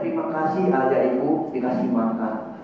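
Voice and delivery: put-on a child thanking parents at bedtime; sweet child's thanks. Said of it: "Cuma dia bilang". (anak berterima kasih dengan manis)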